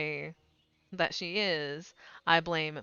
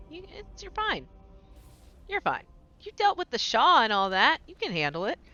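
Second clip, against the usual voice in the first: mock reassurance; 8 semitones higher, 4 dB louder than usual. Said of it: "I'm like".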